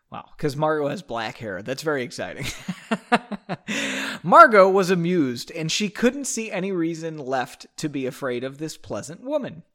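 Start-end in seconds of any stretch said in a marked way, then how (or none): 2.5-4.2 breathy laugh. Real, not acted. Amused by story detail.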